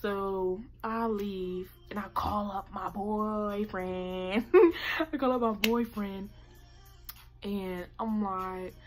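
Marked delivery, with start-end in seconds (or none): 4.5-4.9 loud high burst of laughter; sudden laugh. Real, not acted. Vlogger cracking up mid-story, loud high laugh. Laughs at her own wording.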